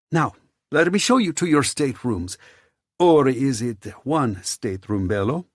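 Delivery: high, playful host voice; flamboyant host. A narrator's reading. Theo shows Giulio to staterooms, flamboyant. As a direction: usher guests along with teasing flourish.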